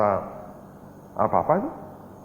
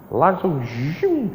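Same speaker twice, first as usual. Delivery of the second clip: zooming car; 7 semitones higher, much slower than usual. Retold or imitated. Car arrives in the story.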